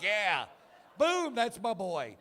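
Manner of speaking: shouted high, slow cheer; dad whooping over a son's first dick joke; proud cheer. Retold or imitated. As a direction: cheer like he hit a home run. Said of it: "I'm like".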